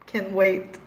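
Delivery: ordinary talk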